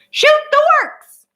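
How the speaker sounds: very high, loud bird shriek; ferocious shriek. A narrator's reading. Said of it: "shrieking ferociously".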